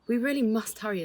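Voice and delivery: a father urging his wife to hurry out; urgent. A narrator's reading. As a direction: hurry her along.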